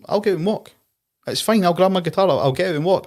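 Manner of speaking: high, light mock English voice; lightly hammed-up impression of a polite star. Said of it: "He goes".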